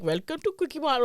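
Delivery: bad Indian accent. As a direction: try a clumsy mock Indian accent. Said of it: "trying to do a bad Indian accent".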